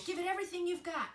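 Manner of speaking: high, loud, fast owl urging; screeching owl. A narrator's reading. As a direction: push the flock harder. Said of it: "screeched the owl".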